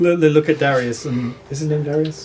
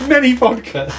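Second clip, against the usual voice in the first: Many vodka repeated higher with glee; delighted repeat; 8 semitones higher than usual. Retold or imitated. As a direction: relish the silly phrase, pitched up. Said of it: "What the fuck?".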